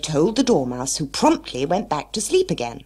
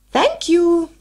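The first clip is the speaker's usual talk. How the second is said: high; 8 dB louder than usual. Acted. March Hare reply.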